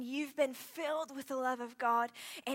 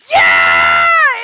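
much higher, much louder yell; team yell; 25 dB louder than usual. Retold or imitated. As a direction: scream it with the whole cabin. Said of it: "And I go".

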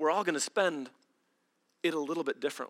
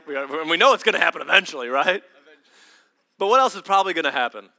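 loud, fast preacher push; preaching loud; 8 dB louder, faster than usual. Acted. Preacher pressing the congregation, loud and fast.

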